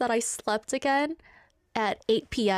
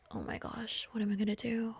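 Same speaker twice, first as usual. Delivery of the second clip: hushed panic; 10 dB quieter than usual. Retold or imitated. Panicking in stuck car.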